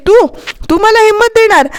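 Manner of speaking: retelling a sister-in-law's scoffing reply; scornful disbelief. Retold or imitated. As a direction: sneer at the very idea of being helped. Sister-in-law mocks offer of courage.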